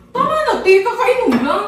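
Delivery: high, loud laughing plea to stop; laughing protest. Real, not acted. Laughing at her sister's request for another drink, high and loud. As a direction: laugh it off while telling her enough.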